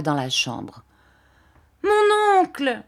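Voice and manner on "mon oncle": a much higher, louder, drawn-out cry; terrible cry. Acted. Emily cries out on seeing her uncle, high and anguished. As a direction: cry out to him in despair. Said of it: "Un cri terrible suivit ces paroles".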